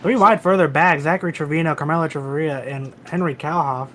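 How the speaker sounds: excited race call. Acted. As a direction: call the battle loudly.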